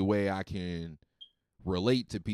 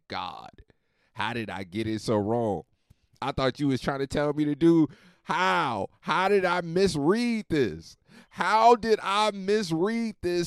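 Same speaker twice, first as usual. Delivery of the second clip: rising, slow, louder lament to God; pleading to God; 6 semitones higher, 5 dB louder than usual. Retold or imitated. Asking God why he got it wrong, a slow rising lament. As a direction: cry out to God, baffled. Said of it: "I said".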